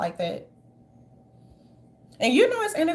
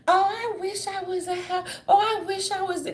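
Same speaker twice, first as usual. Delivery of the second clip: mock whine; 6 semitones higher than usual.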